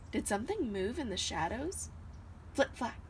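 plain reading voice

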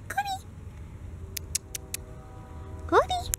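high sing-song call. Real, not acted. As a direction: call the dog from afar. Calling the dog.

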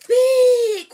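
high loud exclaim; big reveal. Acted. Reader revealing the chick's tummy to babies, high exclaim. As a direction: make the word burst out.

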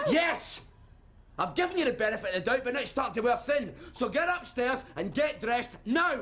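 high, forceful parental command; angry shouting. Acted. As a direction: overrule the child, patience gone. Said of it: "I didn't mean to shout".

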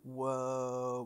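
ordinary talk

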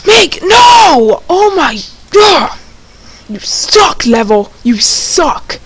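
high, slow, angry shouting; frustrated yelling at game. Real, not acted. Raging at the game.

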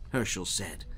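usual speaking voice

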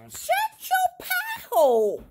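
high loud mock shout; playful shout. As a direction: yell it out in silly fun.

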